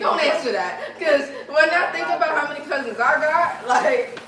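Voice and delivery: words spilling out through real laughter; laughing. Real, not acted. Laughing about counting her cousins.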